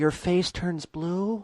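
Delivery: high, drawn-out puzzled question; mock baffled student. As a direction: stretch it out like a dim classmate. Mimicking baffled beginners in the class.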